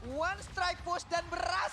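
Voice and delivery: slow, loud hype shout; excited shout. Real, not acted. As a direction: call the final push to the crowd. Caster calls the winning push.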